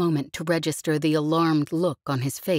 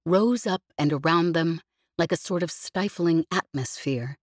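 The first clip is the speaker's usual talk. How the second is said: ordinary delivery, nothing marked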